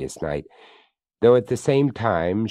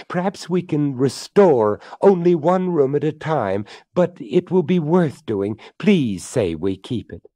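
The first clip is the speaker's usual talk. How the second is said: high, eager and pleading; delighted; 7 semitones higher, 4 dB louder than usual. Acted. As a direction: gush with delight over the house.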